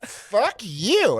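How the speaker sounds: high, indignant mock drunk retort; drunk lady. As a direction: imitate a drunk woman hurling back an insult. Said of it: "this drunk lady walks up".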